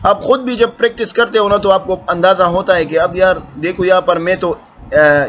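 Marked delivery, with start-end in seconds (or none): none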